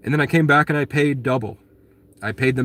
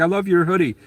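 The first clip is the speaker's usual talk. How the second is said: higher, mock-sweet praise; fake sweet compliment; 7 semitones higher than usual. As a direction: pour on put-on friendliness. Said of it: "And I was like".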